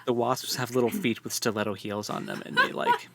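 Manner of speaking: ordinary talk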